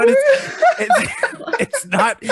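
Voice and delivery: high squeaky giggle through words; can't get words out for laughing. Real, not acted.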